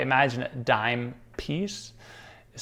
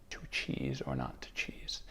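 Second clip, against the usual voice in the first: dramatic whisper; 11 semitones lower, 13 dB quieter than usual. Acted. Hamlet parody aside.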